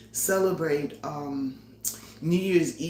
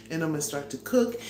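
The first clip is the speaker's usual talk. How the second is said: normal talking voice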